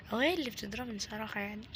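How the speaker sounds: ordinary talk, nothing marked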